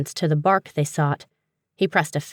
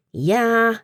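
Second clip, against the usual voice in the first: strained, higher reply; tight-jawed; 6 semitones higher than usual. A narrator's reading. Struggling with the unruly book, tight-jawed. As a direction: answer through a tight jaw. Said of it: "Dewey said with a tight jaw".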